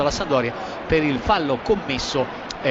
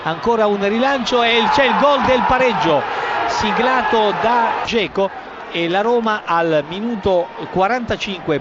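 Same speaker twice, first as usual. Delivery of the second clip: excited goal call. Real, not acted. Equaliser called live.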